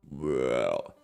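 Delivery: normal talking voice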